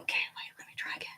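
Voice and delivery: soft whisper while concentrating; hushed self-talk. Real, not acted. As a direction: murmur instructions to yourself in a whisper. Careful balancing task.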